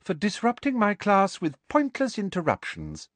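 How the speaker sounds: high, even teacher's rebuke; smooth. A narrator's reading. Teacher explains punishment to the class, smooth. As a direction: glide through the reprimand smoothly. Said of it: "said Professor Umbridge smoothly".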